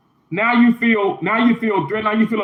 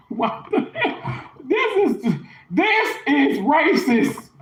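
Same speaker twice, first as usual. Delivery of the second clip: incredulous laugh; 7 semitones higher, much slower than usual. Real, not acted. Reacting in disbelief to the accusation.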